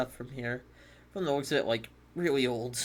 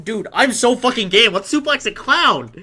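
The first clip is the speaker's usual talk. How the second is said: loud, high, pumped up yell; hyped shout; 10 semitones higher, 13 dB louder than usual. Real, not acted. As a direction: shout it out all fired up. Hyped shouting about suplex.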